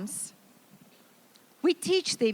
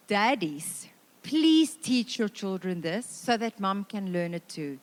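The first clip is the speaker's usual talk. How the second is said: ordinary talk, nothing marked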